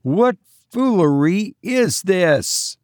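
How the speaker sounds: high, slow, drawn-out call. A narrator's reading. Narrator challenges the fleeing boat, calling out. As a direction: call out to someone far off. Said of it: "I called".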